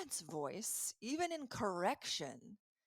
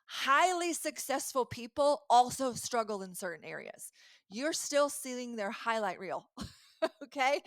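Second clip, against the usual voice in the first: louder and higher, pressing the point home; emphatic reassurance; 4 semitones higher, 7 dB louder than usual. Shown as a demonstration. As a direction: sell the reassurance with energy. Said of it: "Let me normalize this".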